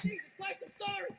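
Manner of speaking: high, flustered apologizing; acted exasperated pleading; panicked apology. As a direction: apologize urgently while hauling back a dog. Retold apology while pulling dog back.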